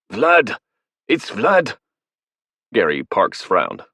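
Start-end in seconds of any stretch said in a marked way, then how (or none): 0.1-1.8 high, loud, panicked cry; frightened blurt. Acted. Academic revealing the monster to the soldiers, panicked. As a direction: blurt it out in terror.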